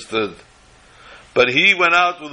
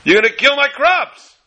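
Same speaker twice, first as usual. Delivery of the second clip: crying out to heaven; 6 semitones higher than usual. Retold or imitated. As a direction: call up to God from the field. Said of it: "I cry out to our Father in heaven".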